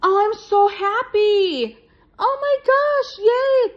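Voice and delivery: high, loud happy squeals; thrilled squealing yay over long-wanted stickers; overjoyed. Real, not acted. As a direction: squeal with delight.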